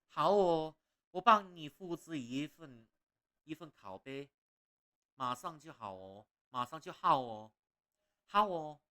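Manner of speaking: put-on Malaysian-accent fish merchant eager to make a copy. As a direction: bounce each 好哦 in a cheerful Malaysian accent. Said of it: "That's just a Malaysian accent".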